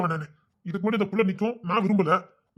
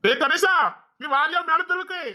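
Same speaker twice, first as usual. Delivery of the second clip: high, drawn-out call down the street; 11 semitones higher, slower than usual. Acted. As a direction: call to a friend far away. Signals by calling out to friends. (தூரத்தில் இருப்பவரை உரக்கக் கூப்பிடுவது)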